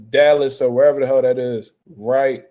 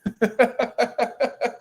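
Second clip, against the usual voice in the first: higher run of short chuckles; amused laugh; 7 semitones higher than usual. Real, not acted. Streamer laughing after voicing the boxer's reply, amused laugh. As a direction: chuckle at your own joke.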